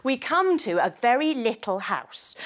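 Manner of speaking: normal talking voice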